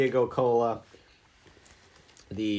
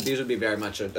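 normal talking voice

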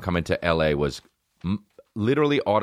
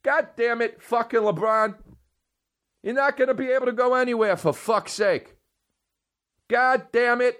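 put-on much higher, griping New York accent dad. A New York dad griping about LeBron and traffic. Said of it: "I have a New York accent".

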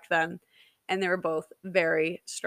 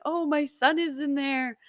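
higher-pitched imitation of his excitement; excited partner; 7 semitones higher than usual. Partner guessing it's a boy, excited and high. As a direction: act out his delighted certainty, pitched up. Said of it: "he got super excited".

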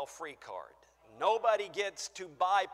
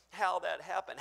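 ordinary talk

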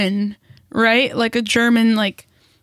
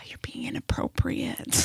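hushed, slower whisper; whispered aside; 12 dB quieter than usual. Retold or imitated. Telling the coworker he's inappropriate, in a whisper. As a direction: whisper it like a discreet warning. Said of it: "the thing of".

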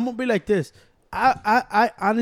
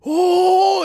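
much higher, loud yell; crowd yell; 10 semitones higher, 8 dB louder than usual. Retold or imitated. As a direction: shout it like a stunned fan. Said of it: "hear Henry go".

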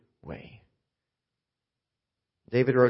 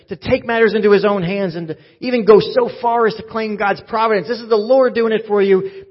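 rising, louder preacher's build; preaching at full force; 7 semitones higher, 8 dB louder than usual. Acted. Pastor urging his congregation, building to a full-force preach. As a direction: drive it home to the congregation, louder and higher.

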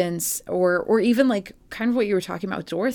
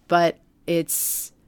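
ordinary delivery

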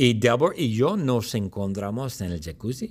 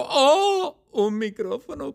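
very high excited cry; excited squeal; 3 dB louder than usual. Acted. [name] seeing the microphone, squealing very high. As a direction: squeal with pure delight. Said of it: "y yo grito".

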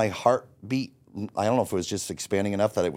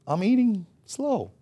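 mock innocent; 11 semitones higher than usual. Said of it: "And I would be like".

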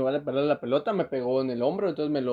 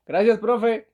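mock student; 7 semitones higher, 5 dB louder, much slower than usual. Students mocking teacher's threat.

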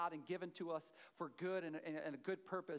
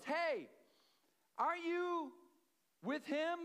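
put-on young girl's voice; 10 semitones higher, 4 dB louder, slower than usual. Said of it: "And she goes".

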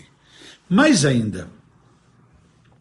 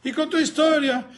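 normal talking voice

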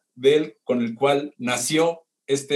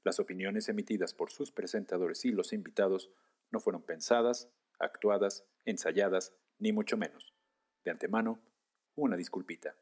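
quiet, solemn disclaimer voice; formal disclaimer; 5 semitones lower, 11 dB quieter than usual. Acted. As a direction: read it like a formal warning. (aviso formal y solemne)

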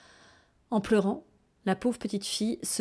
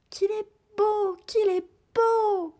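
very high, slow swooning sigh; high, dreamy child's gasp of love; lovestruck girl; 4 dB louder, slower than usual. Acted. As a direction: melt with love as the little girl.

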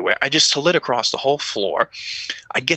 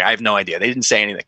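ordinary talk

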